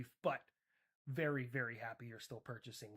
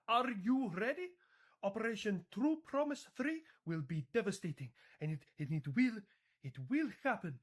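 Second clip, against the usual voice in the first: higher-pitched, mock Iranian accent; 6 semitones higher, 10 dB louder than usual. Iran threatens its devastating operation, in a mock Iranian accent. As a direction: boast in a put-on Iranian accent. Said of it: "so hard to say in this accent".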